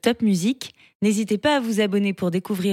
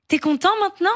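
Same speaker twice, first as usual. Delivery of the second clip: put-on higher child voice correcting his name; a little boy setting the record straight; lightly voiced young boy; indignant boy; 9 semitones higher, much faster than usual. Julien asks.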